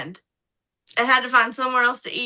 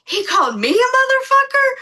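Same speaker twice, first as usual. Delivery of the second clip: much higher, louder, outraged disbelief; shocked outrage; 12 semitones higher, 6 dB louder, faster than usual. Retold or imitated. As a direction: gasp it out, stunned and angry. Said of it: "and he's like".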